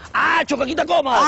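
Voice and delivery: drawn-out bus tout's cry; route-call demonstration. Shown as a demonstration. Driver shows conductor what to shout, calling out. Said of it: "Todas esas cositas las tienes que decir".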